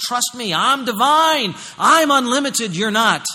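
high, loud declaration from the pulpit; full-force preaching. Acted. Preacher voicing God saying trust me, full-force preaching.

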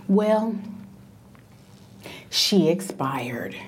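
low, slow, deadpan delivery; flat nurse. Retold or imitated. Male nurse telling her the grandmother expired, deadpan. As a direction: drop the news without feeling.

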